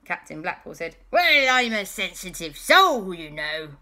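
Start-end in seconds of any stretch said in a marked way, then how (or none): 1.1-3.8 wounded pirate. Acted. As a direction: plead hurt feelings as a hammy villain. Pirate pleads sensitivity.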